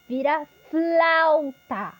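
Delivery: "flauta" stretched slowly, the FL cluster stressed; drawn-out "flauta" for a child; slow demo word. Shown as a demonstration. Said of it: "o som dessas sílabas mais complexas".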